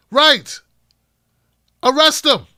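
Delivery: loud emphatic agreement; shouting. Real, not acted. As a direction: call it out loud and high. Host shouting agreement at callers.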